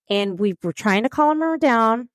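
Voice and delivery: usual speaking voice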